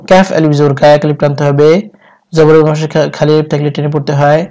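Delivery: ordinary delivery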